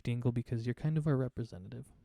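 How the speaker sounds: normal talking voice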